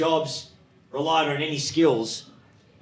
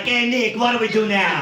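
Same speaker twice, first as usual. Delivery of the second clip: high, louder questioning call; raised voice seeking orders from the boss; eager backpackers; 8 semitones higher, 5 dB louder than usual. Retold or imitated. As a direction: pipe up like a clueless junior worker. Young backpackers asking their supposed boss.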